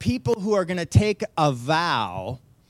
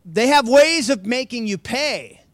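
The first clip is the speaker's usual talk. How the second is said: menacing Eastern European villain voice, raised and louder; mock Eastern European accent; 8 semitones higher, 6 dB louder than usual. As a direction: ham up a Czech accent threatening payback. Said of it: "Eastern, Czechoslovakian accent".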